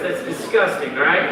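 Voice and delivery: ordinary delivery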